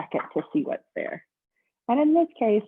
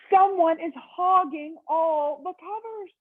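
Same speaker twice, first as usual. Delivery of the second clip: much higher, louder, indignant phone complaint; complaining guest; 10 semitones higher, 3 dB louder, slower than usual. Acted. Mister Snore phoning the front desk about his pillow, indignant.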